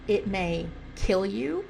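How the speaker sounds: ordinary delivery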